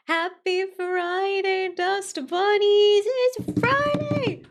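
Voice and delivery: drawn-out, high hyped shout; excited cheer. Real, not acted. Streamer greeting viewers for Friday, excited cheer. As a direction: cheer it loud and high, stretching every word.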